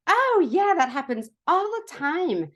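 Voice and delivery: breezy sponsor. Retold or imitated. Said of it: "My sponsor was like".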